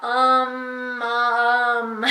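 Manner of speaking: "um, um" drawn out with a vowel stuck on; added vowel sounds. Shown as a demonstration. Teacher showing how mom gets sounded out wrong, "um, um" stretched with added vowels. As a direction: sound out "um, um" in long held sounds. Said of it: "if they're sounding out mom, they're gonna be sounding out".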